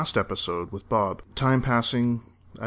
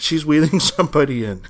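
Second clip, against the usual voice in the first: laughing put-on voice; 6 semitones higher, 6 dB louder than usual. Joking echo in accent, laughing.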